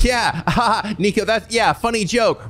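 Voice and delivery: high-pitched mock-amused voice; commenters playing along. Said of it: "Everyone in the comments like".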